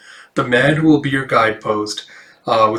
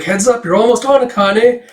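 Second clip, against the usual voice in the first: a higher, louder heads-up; calling her; 9 semitones higher, 4 dB louder than usual. Acted. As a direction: call her to the stage. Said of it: "Un-Un's like".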